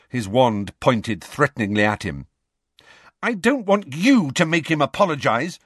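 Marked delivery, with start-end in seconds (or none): 3.2-5.6 high, raised angry voice; Lily rounding on James, shouting; shouted. A narrator's reading. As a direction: snap it at James. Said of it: "Lily shouted".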